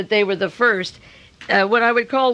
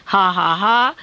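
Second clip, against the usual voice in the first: mock "ha, ha, ha", rising at the end; sarcastic laugh; 3 dB louder, slower than usual. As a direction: scoff with a deliberate fake laugh. Sarcasm after "the great liberal.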